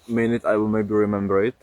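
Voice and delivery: normal talking voice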